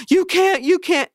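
very high, whiny cry of protest; mock wailing protest. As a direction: wail it like a frantic dad on the phone. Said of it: "crying and begging".

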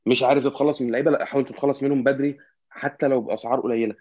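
normal talking voice

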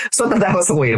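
high, laughing breath still in it; giggly, just out of a laugh; after laughing hard. Real, not acted.

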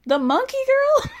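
high, laughing mock question; cracking up while mocking the label; laughing disbelief. Real, not acted. Vlogger laughing at Danielle calling herself the monkey girl.

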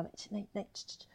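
near-silent, hushed hiss of no and shh; hushed, secretive shushing of a child. Retold or imitated. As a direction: shush the little one so nobody hears.

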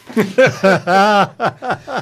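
loud laugh at the punchline; big laugh. Real, not acted.

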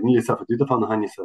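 fast, clipped Donegal Irish imitation. Imitating Donegal speech for the host, clipped and fast. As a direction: rattle it off in clipped Donegal Irish, swallowing consonants. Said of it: "apologies for the, uh, attempted, uh, uh, imitation".

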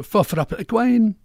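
"fuff rap a quine" in slow broad Doric; Doric Scots. Shown as a demonstration. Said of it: "That's a".

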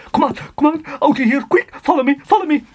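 usual speaking voice

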